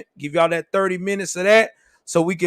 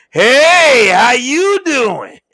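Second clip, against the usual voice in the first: a slow, loud, higher come-on "hey, how you doing"; 5 semitones higher, 7 dB louder, much slower than usual. Retold or imitated. Old dude hitting on wife.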